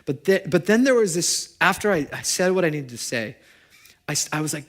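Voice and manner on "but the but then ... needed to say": ordinary talk, nothing marked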